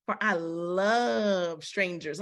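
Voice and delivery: slow, brazen and defiant; in your face. Retold or imitated. Preacher voicing Israel's brazen reply, slow and defiant. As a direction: say it right in their face. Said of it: "put it in the face".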